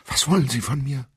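high, loud frightened outburst; blurted in fear. Acted. Captive woman cries out to her silent abductor. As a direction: burst it out in panic. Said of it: "stieß sie hervor".

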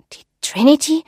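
hesitant echo of a ship's name; startled question. Acted. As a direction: ask it haltingly, pitch lifting in surprise. Marie asks, startled by the name.

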